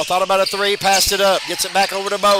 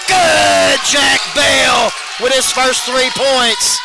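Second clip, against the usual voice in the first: loud, higher shout of the made three; excited call; 5 semitones higher, 4 dB louder, much slower than usual. Real, not acted. Announcer calls Jack Bell's made three with an excited shout. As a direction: celebrate the basket at full voice.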